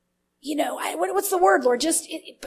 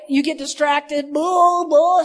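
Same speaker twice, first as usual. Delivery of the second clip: ordinary talk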